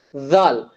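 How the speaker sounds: ஜால் with voiced "th" as in this; standard Dhal. Shown as a demonstration. Teacher introducing the next letter, ஜால் with voiced TH. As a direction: press tongue under upper teeth to start ஜால். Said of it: "அழுத்தத்தோட வரக்கூடிய sound".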